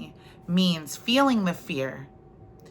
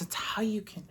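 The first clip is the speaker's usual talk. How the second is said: whispered softly; 2 semitones higher, 10 dB quieter than usual. Real, not acted. Speaker easing listeners into relaxing, whispered. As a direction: drop to a hush.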